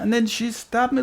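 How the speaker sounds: usual speaking voice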